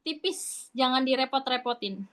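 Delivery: ordinary delivery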